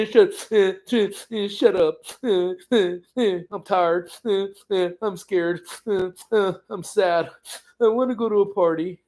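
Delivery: hammed-up sniffly crying voice; mock sobs breaking up the words; Amy crying. Imitating upset friend left out of party, mock crying. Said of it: "her sniffling in the kitchen".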